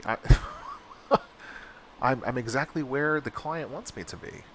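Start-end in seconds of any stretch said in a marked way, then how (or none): 0.2-2.0 disbelieving laugh. Real, not acted. Laughs at the absurdity.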